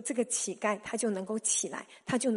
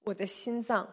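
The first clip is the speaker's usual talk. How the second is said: normal talking voice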